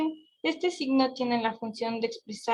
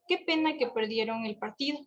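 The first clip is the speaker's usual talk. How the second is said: ordinary talk, nothing marked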